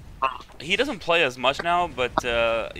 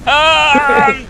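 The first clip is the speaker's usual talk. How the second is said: pained yelp; 13 semitones higher, 10 dB louder than usual. Real, not acted. Getting shot down in game.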